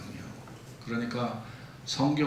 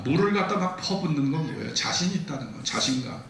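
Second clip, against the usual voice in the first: ordinary talk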